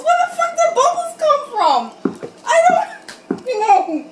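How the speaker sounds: laughing outrage at the book; incredulous shout. Real, not acted. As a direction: shout it at the absurd page. Outburst at absurd text.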